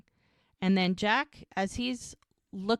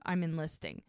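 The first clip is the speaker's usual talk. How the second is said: ordinary talk, nothing marked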